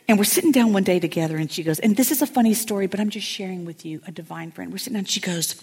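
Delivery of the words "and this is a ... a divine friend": ordinary talk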